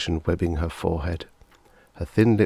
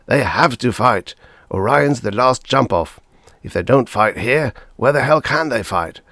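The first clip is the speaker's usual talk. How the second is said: louder, fierce retort; savage swearing; 5 semitones higher, 6 dB louder than usual. A narrator's reading. Father snapping back at his wife, louder and fierce. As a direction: tear into her argument. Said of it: "Rossi swore savagely".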